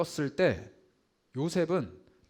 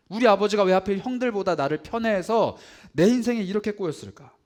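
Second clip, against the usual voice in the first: louder, higher lament pushed out hard; anguished outcry; 4 semitones higher, 7 dB louder than usual. Retold or imitated. Preacher voicing Joseph crying out against his father. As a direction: let the complaint burst out.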